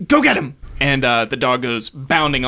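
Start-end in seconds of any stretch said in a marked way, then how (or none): 0.1-0.6 shepherd's command. Acted.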